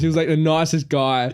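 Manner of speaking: ordinary talk, nothing marked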